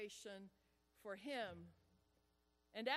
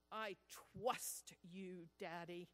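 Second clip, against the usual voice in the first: put-on trusting child; 6 semitones lower, slower than usual. Son looking up at his father, slow tender trust. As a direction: let it linger, tender and slow. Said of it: "the words I will never forget".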